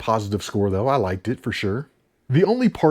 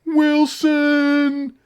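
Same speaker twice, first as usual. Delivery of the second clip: high, loud desperate shout; Castaway yell; 4 dB louder than usual. Retold or imitated. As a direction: scream the name across the ocean. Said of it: "with Tom Hanks".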